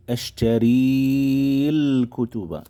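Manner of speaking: ashtaril kutuba said slowly, the long i of ashtari dropped before al; slow, drawn-out drill of ashtaril kutuba; slow careful reading. Shown as a demonstration. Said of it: "huruf panjang kita ditulis, tapi nggak dibaca".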